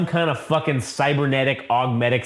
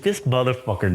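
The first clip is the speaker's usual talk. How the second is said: normal talking voice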